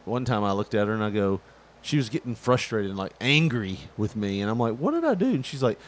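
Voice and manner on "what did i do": baffled. Retold or imitated. Husband's baffled reply.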